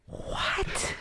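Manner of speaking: voiceless, breath-only "what"; imitated whispered disbelief; stunned disbelief. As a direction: whisper it as if dumbfounded. Reaction to the absurd carpet remark.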